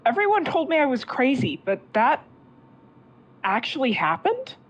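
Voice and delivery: high, fast disbelieving rush; stunned disbelief. Acted. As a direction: blurt it out in shock. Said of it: "she just says".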